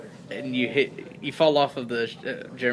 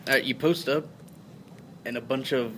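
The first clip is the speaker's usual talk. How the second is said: ordinary talk, nothing marked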